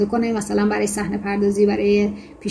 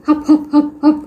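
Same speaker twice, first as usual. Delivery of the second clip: short, high, punchy hap-hap laugh; mock barking laugh; 5 semitones higher, 5 dB louder than usual. A narrator's reading. Parker laughs at Freddy's beard, mock barking laugh. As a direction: imitate a laugh in separate barked bursts. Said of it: "ناگهان پارکر به ریش فردی خندید".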